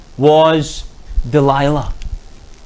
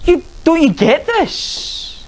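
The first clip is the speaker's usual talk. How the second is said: high slow incredulous question; raised, drawn-out exasperation; exasperated disbelief; 9 semitones higher, slower than usual. Real, not acted. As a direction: plead with them to see it. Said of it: "Surely you would think".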